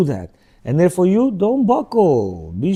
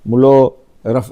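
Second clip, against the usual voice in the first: normal talking voice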